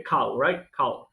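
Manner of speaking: usual speaking voice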